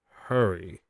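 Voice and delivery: usual speaking voice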